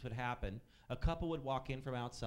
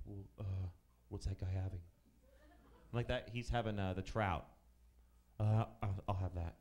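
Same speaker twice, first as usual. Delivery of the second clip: lower, spaced-out mock voice; odd woman customer; 7 semitones lower than usual. Waiter imitating a vague woman ordering, lower. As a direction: play her vague and distracted, lower.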